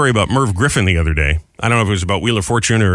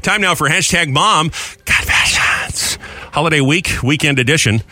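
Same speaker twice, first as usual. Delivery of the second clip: slow segment intro read; host announcing the segment; radio announcer; 4 semitones higher, slower than usual. Acted.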